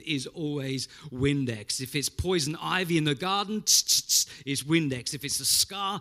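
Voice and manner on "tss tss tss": hissing tss of a spray bottle; spray bottle noise. Retold or imitated. Preacher mimics Windex spray for congregation, hissing spritz.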